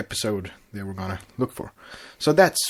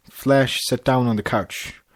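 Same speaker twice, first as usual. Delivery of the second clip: ordinary delivery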